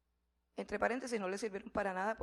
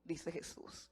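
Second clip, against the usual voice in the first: ordinary talk, nothing marked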